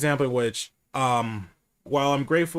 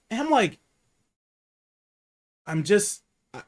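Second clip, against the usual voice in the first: normal talking voice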